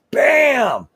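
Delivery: high, loud bam; shouted impact. Acted. Wrestler acting out a European to the neck, explosive shout. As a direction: fire it out like a punch landing.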